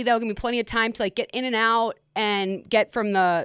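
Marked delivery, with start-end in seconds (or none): none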